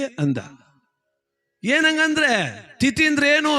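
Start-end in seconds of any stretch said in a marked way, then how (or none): 1.6-3.6 higher, slow, fed-up question; exasperated repeat. Retold or imitated. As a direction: drag the question out in disbelief. Officer asks again, exasperated.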